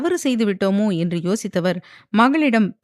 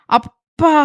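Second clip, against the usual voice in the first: sharp, high, louder shocked "Dad!"; startled cry; 8 semitones higher, 6 dB louder, much slower than usual. A narrator's reading. Daughter startled by her father's question, shocked cry. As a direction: gasp it out in shock. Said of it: "அதிர்ந்து அவரை பார்த்தாள்".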